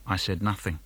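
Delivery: soft, low sulky murmur; sulky quiet. Acted. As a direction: say it under your breath. Sulky silence line.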